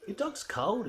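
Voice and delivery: high fussy voice; lampooning a fussy stranger at the shops; mocking nosy stranger. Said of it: "people look at you like".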